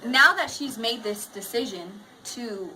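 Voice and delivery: lively read-aloud voice for the class; reading to children. A narrator's reading. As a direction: read the picture book to students. Teacher reads story aloud.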